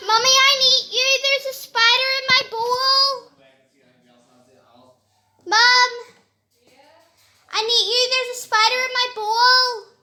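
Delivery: high, loud panicked yelling; screaming at a spider. Real, not acted. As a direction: scream for mom in fright. Screams for mom.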